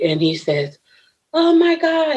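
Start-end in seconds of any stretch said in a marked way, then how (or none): 1.3-2.2 hollering. Retold or imitated. Truck driver hearing the victim answer the phone, high holler. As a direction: holler it high in amazed relief. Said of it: "He start hollering".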